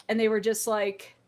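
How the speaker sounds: normal talking voice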